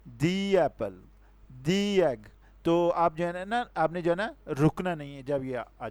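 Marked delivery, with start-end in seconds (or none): 0.1-1.0 दी said as a long "dee" before Apple; teacher says दी clearly as "dee". Shown as a demonstration. Teacher shows students दी before a vowel, clear "dee". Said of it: "दूसरा rule उसका ये है".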